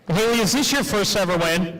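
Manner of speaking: ordinary talk, nothing marked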